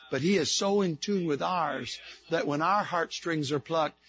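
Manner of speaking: quiet tender explanation of touched; soft sermon reflection. Acted. Preacher softens to intimate teaching.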